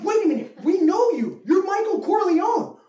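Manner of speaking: high-pitched, pleading protest; hammed-up incredulous complaint; mock disbelief. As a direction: object as a baffled, let-down fan. Acted protest of baffled audience.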